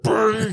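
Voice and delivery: playful imitated key-bashing; mock piano noise. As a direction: buzz out a bad piano run. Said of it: "If you just go like".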